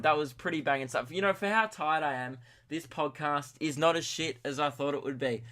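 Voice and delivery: ordinary talk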